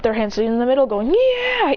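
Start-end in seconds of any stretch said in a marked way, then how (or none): 1.1-1.7 high, celebrating "yeah"; team cheer. Acted. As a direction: cheer high as the hands come together. Team cheers with hands in middle.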